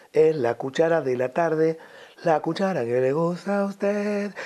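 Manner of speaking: slow, drawn-out 1940s jingle delivery; mock old-fashioned advertising jingle; old-time radio jingle. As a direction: recite it like a vintage radio ad, stretching the line endings. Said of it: "de corte antiguo, de Radio mil novecientos cuarenta".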